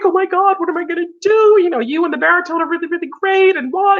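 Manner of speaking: imitating crying mezzo. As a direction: mimic her panic through tears. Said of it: "she burst into tears".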